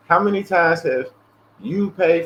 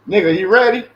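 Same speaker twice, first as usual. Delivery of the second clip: friend calling out; 7 semitones higher, 7 dB louder than usual. Retold or imitated.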